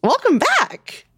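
high, slow, squeaky welcome; silly high voice. Acted. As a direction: stretch it out up high.

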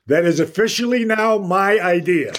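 high, loud announcement to the room; loud proclamation. Retold or imitated. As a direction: proclaim it as if standing before the whole room. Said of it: "stands up in the middle of the room and he says".

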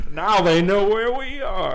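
high laughing mock panic. Real, not acted. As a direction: laugh it out in fake alarm. Mock alarm after laugh.

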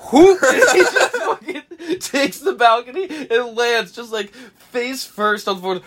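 high, laughing hard while telling. Real, not acted. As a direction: crack up telling it.

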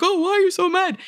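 high, brushing-off mock question; dismissive partner. Partner waving off your frustration, mock dismissive and high. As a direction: toss it off like it's no big deal. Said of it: "and they're like".

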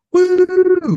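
high, loud party whoop; whooping yell. Retold or imitated. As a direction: whoop like a cheering crowd. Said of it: "with the whistling".

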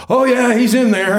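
high, laughing mock Donald voice; imitating Donald. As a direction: give him up with a laugh. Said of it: "I can hear Donald going".

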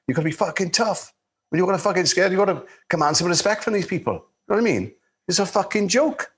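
high-pitched, worked-up imitation of an irascible director; irascible telling-off. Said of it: "He was a very tough, irascible".

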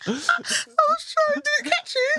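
high, wheezing laughter through the words; can barely speak for laughing; crying with laughter. Real, not acted. Guest explains her burp to the hosts, helpless with laughter.

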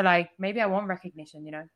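ordinary delivery

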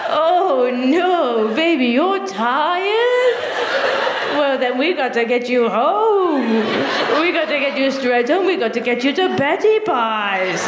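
put-on slow, drawn-out cooing to a baby; baby talk. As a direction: fuss over a tired partner like a toddler. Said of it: "Baby talk".

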